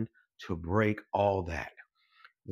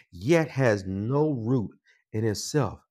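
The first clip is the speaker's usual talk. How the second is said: normal talking voice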